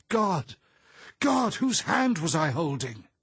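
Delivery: very high, loud terrified cry; hysterical, shrieked question; horrified scream. A narrator's reading. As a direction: scream in dawning horror. Horror at unknown hand held.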